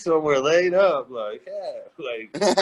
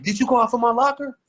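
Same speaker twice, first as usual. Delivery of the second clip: mock angry confrontation; 10 semitones higher, 5 dB louder, faster than usual. KG confronting rookie at locker.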